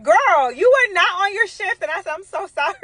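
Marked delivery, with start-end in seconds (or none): 0.0-1.8 scolding nurse. Retold or imitated. 2.1-2.7 mock apology. Apologising to the nurse.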